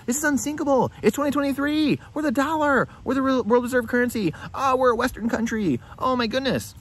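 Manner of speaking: high, mocking voice of a carefree passenger; lightly hammed-up naive optimist; mock complacent passenger. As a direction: parody someone who insists all is fine. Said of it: "most people on there saying".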